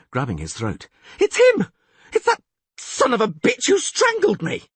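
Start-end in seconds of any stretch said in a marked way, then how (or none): 1.2-4.7 very high, loud panicked yell; terrified shout. Acted. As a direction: scream it at the window. Bard sees his attacker.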